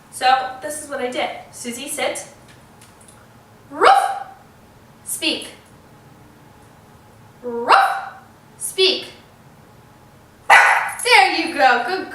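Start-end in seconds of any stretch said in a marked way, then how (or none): 3.7-4.3 sharp high loud bark; barking like a dog. Shown as a demonstration. Owner barking to teach her dog to speak, loud and high. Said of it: "I actually had to speak". 7.4-8.1 loud bark; dog bark. Shown as a demonstration. Owner barking so the dog speaks, loud bark. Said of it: "I actually had to speak".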